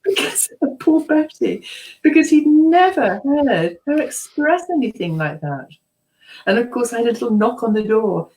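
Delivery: ordinary talk, nothing marked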